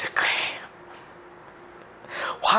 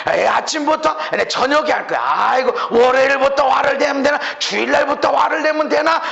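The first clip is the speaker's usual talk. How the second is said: louder, rising mock protest; acted self-talk; 11 semitones higher, 8 dB louder than usual. Preacher playing someone putting off anger till later, mock protesting. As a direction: talk yourself out of anger, building to a raised voice.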